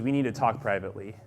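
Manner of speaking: low, quiet, quick aside; understated private request; confidential. Retold or imitated. Mentor privately confides in teller.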